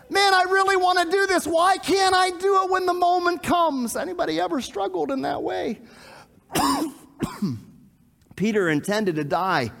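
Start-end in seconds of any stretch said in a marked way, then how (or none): 0.1-4.0 frustrated plea. Acted. Preacher voices a believer failing at temptation, frustrated plea. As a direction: plead it out, pitched well up.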